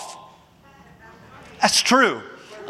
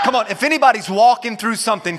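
ordinary talk

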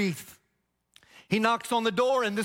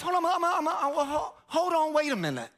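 higher, stammering in shock; stunned stammer; 6 semitones higher than usual. Acted. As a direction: trip over the words in disbelief. Tax collector sees blind man healed.

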